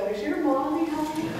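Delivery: put-on high, sweet talking-to-a-little-girl tone; talking to a child. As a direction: imitate callers addressing a small child. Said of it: "Mom had a very high little girl's voice".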